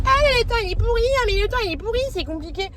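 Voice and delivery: high, singsong whine, mocking people who moan; mocking everyone's whine. Vlogger mocks her viewers' weather complaints, high mock whine. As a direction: parody the neighbours repeating the weather complaint. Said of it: "tout le monde vous dit".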